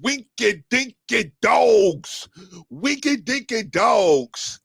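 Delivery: high and slow, a drawn-out goofy chant; movie catchphrase. Pretend. Inviting viewers to chant the line with him, high and slow. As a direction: stretch each word out, silly and high. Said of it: "Bobby, say it with me".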